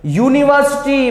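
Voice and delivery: यूनिवर्सिटी begins with a y glide, "yoo"; yoo start. Shown as a demonstration. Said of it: "अगर यू आ रहा है".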